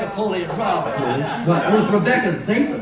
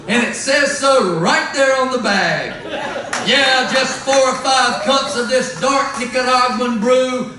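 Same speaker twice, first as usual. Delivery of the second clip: loud hammed-up infomercial pitch, higher and louder; mock commercial; 4 semitones higher, 3 dB louder than usual. Singer reading a fake sponsor ad to the crowd, booming salesman voice. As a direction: sell the anti-fascist coffee like a TV ad. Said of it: "I've had to take on a corporate sponsor".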